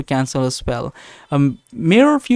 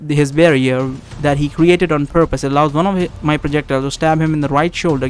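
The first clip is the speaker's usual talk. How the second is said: ordinary delivery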